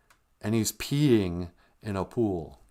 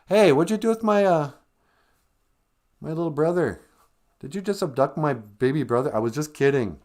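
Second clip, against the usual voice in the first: mock Sarah; 6 semitones higher, 4 dB louder than usual. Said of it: "And girl goes".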